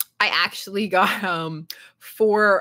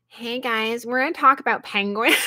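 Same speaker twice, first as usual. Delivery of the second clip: nerd voice; 2 semitones higher than usual. Pretend. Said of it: "it's like a nerd voice".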